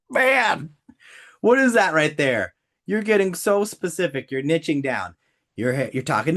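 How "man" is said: tearful. Real, not acted. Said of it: "crying after customer niche example".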